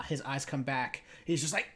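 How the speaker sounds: ordinary talk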